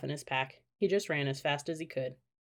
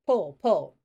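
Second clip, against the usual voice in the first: louder, urgent call to pull; urgent cries; 7 dB louder than usual. A narrator's reading. Jack urging Annie out of the mud hole, loud urgent cry. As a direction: urge her hard to keep pulling.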